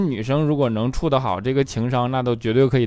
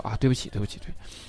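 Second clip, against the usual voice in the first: much softer hurried sorry; meek apology; 2 semitones lower, 8 dB quieter, faster than usual. Retold or imitated. Boyfriend quickly apologizes to girlfriend, much softer. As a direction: sheepishly repeat sorry.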